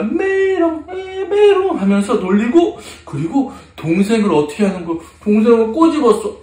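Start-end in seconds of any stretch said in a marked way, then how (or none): 0.0-1.8 high sing-song taunt; mock a friend like a little kid; pitched-way-up playground jeer; teasing. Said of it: "막 놀리는 거야".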